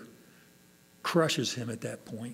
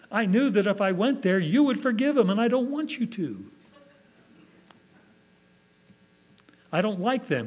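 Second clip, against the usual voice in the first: higher-pitched mock protest to God; indignant Jonah; 7 semitones higher, 4 dB louder than usual. Jonah complains to God about forgiving his enemies, indignant. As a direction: object heatedly, voice raised in pitch. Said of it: "then he became angry".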